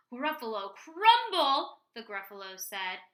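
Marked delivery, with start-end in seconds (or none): 0.1-1.8 high, loud, drawn-out shriek of dismay; big acted monster panic; horrified monster. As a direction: cry it out in shock. Gruffalo horrified.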